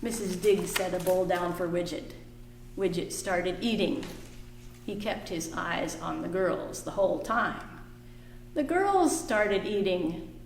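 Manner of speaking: lively picture book reading; reading to children. A narrator's reading. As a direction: read it warmly to the kids.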